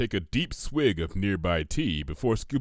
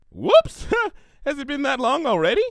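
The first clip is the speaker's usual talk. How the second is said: high, loud, fast and giggly; giggly pony voice; 6 dB louder than usual. A narrator's reading. Pinkie giggles at overdue book to Twilight, high and giggly. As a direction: squeal it like an oblivious party pony.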